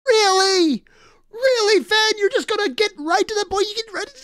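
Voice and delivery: very high, squeaky protest, loud at first; exasperated outburst. Real, not acted. Reactor to the author cutting off at a cliffhanger, high-pitched exasperation. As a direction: cry out in high-pitched disbelief.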